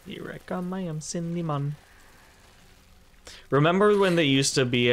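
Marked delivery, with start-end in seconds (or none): 0.1-1.8 hushed, playful mascot line; soft mascot voice. Pretend. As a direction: say it softly like a cartoon. Repeating mascot line softly.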